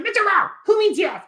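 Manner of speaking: very high, exasperated owl voice; squawky-high owl correction; Snowy. Acted. Owl corrects the teacher, high and exasperated. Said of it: "eventually Snowy will say".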